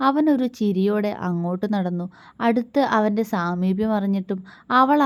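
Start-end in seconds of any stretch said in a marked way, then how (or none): none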